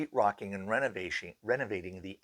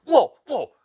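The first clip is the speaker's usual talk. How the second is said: high, loud spooked voice, calming down; rattled and needing a break; freaked out; 6 semitones higher, 7 dB louder than usual. Retold or imitated. Sheetrocker spooked by the ghost news: high, loud freaked-out voice. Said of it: "And he goes".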